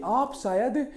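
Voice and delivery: slowly, word by word; slow, deliberate classroom recital; slow mnemonic sentence. Shown as a demonstration. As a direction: spell out the memory line slowly so it sticks. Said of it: "वो कह रहा है".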